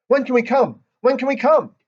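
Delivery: put-on excited little girl pleading; eager begging. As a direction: bounce around begging to come. Grace dancing, begging eagerly.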